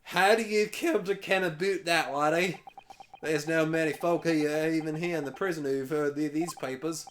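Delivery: put-on thick Scottish accent. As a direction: read it as a gruff Scottish jailer. Voicing Scottish game character.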